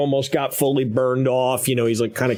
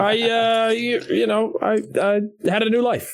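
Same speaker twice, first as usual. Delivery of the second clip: much higher comic NPC voice; voiced NPC confessing a new life; high put-on character voice; 10 semitones higher than usual. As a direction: squeak it out like a sheepish crook.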